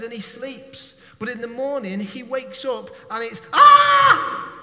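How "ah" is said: high, loud startled scream; panicked scream. Acted. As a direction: scream at the army on the doorstep. Servant wakes to army outside.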